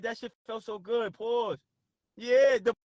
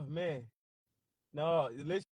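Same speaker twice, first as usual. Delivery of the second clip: ordinary talk, nothing marked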